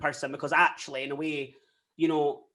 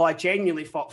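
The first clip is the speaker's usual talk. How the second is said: usual speaking voice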